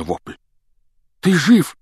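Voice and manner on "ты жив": high, loud alarmed question; frightened. A narrator's reading. Harry checks on Ron after the crash, frightened. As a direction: ask in a fright, voice jumping up. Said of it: "в испуге спросил Гарри".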